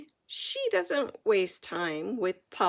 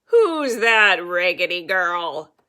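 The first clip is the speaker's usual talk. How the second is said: loud, gruff royal demand; growling king; 3 semitones lower, 13 dB louder than usual. A narrator's reading. King glaring out at the girl, growling loudly. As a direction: growl it down from the castle. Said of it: "the king looks out the castle and growls".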